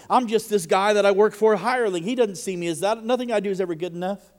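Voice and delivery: loud and high, bitter protest; preacher acting the man's hurt anger; retold frustrated complaint. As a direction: belt out the hurt of being unseen. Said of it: "And he said".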